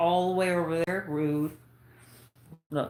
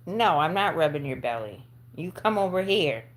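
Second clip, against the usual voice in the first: ordinary talk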